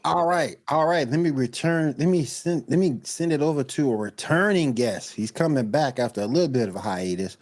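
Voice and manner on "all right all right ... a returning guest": upbeat show host intro. Acted. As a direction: introduce the guest like a talk host. Host introduces next guest.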